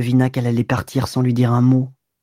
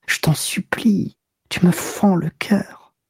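tearful whispered plea; 5 semitones higher, slower than usual. Acted. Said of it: "Il murmura".

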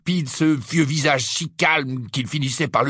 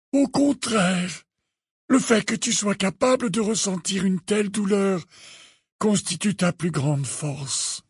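put-on high, slow old headmaster's voice; measured, gentle insistence, pitched high; calm; 10 semitones higher than usual. A narrator's reading. Dumbledore telling Harry pain is strength, calm. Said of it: "de la même voix calme".

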